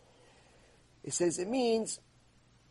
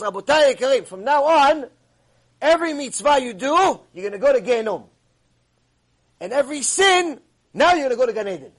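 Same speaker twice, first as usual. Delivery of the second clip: loud, raised public proclamation; heavenly voice proclaiming; 3 semitones higher, 6 dB louder than usual. Acted. Heavenly voice decrees to the rabbis, loud proclamation. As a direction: announce a decree, pausing between clauses. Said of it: "as a bat kol comes from Shamayim".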